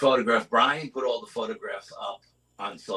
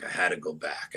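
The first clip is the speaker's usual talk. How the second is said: 1 fading, quieter; 6 semitones lower, 5 dB quieter than usual. Real, not acted. Repeating, drawn back to the ridge.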